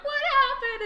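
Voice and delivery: put-on high, loud, panicked child's cry. Child running to mom after the game shuts off, loud panicked cry. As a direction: squeal it like a distraught kid. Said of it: "I was like".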